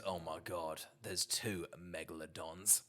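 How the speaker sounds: low, soft, slow deadpan; hushed awestruck action-hero read; mimicking Statham. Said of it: "But if he says".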